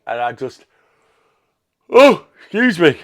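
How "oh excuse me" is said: yawning. Real, not acted. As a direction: excuse me said through a real yawn, higher and louder. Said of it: "No yawning".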